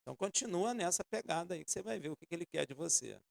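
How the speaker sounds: ordinary talk, nothing marked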